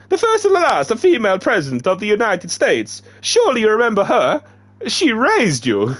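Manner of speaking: very high, loud put-on accent for The first and last female president. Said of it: "this is in the accent".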